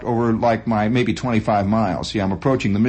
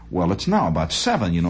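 usual speaking voice

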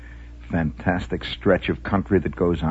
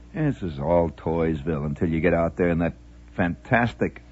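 ordinary talk, nothing marked

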